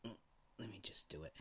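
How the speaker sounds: whispered weary sigh. Acted. Tired doctor sighs before calling another contact, soft whispered sigh. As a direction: breathe it out, defeated. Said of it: "the doctor just sighs".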